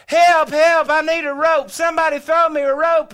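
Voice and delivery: high, loud call for rescue; loud, high-pitched cry for help; imagined yell for help. Retold or imitated. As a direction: holler it out across the river. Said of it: "I know I'll yell".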